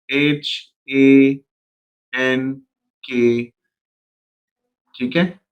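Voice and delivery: normal talking voice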